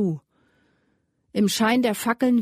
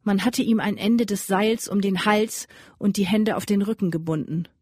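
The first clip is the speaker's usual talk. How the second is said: ordinary delivery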